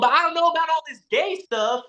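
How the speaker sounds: high, fast mock pop-star voice; sing-song it like a pop star bragging.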